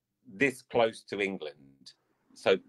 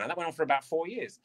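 usual speaking voice

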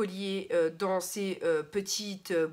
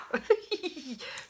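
relieved laugh; 3 semitones higher, 4 dB quieter than usual. Real, not acted. Laughs after struggling with wax.

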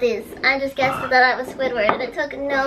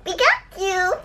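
high squeaky cartoon creature call; Pikachu voice; 7 semitones higher, much slower than usual. Voices the Pokemon after guessing it.

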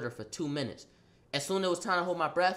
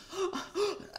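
a gasp, higher; high sharp gasp; 13 semitones higher, 7 dB quieter than usual. Retold or imitated. Mid fight, a quick high gasp. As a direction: suck in air before the blows.

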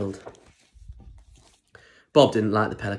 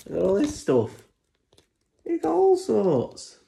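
much higher, wide-eyed delight; amazed; 10 semitones higher than usual. Real, not acted. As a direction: gasp at the pile of stuff. Showing the picture, amazed.

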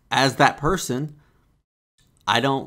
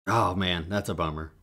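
softer, lower laid-back that's a bummer; low-key sympathy; 7 semitones lower, 6 dB quieter than usual. Retold or imitated. Friend hearing bad news, soft laid-back sympathy. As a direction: shrug it off gently. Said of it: "I wanna say".

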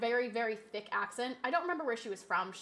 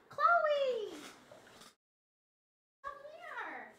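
high cooing pet voice; 13 semitones higher, slower than usual. Real, not acted. Streamer coos at her cat Chloe on stream, high pet baby talk. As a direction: coo at the kitty sweetly.

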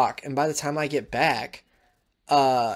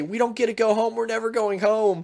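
put-on higher, a child's wailing complaint; upset kids; 9 semitones higher than usual. Stranded kids telling each other, upset. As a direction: voice scared children who think they're stuck.

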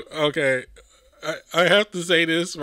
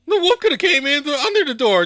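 exasperated rant; 7 semitones higher, 8 dB louder, faster than usual. Real, not acted. Exasperated at the flimsy door.

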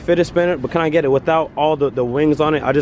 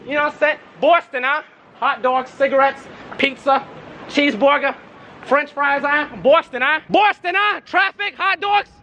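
Boston hype guy; 10 semitones higher than usual. Acted. Boston hype chant character.

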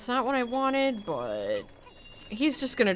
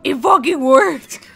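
loud, higher burst of disbelief; astonished outburst; 4 semitones higher, 8 dB louder than usual. Real, not acted. Player finding the spray worked, astonished outburst. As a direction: blurt it out, amazed.

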